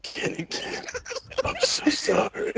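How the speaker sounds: soft mock-tearful whimper; whisper, then squeak out fake tears; bad game impression. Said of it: "The impressions are getting worse and worse".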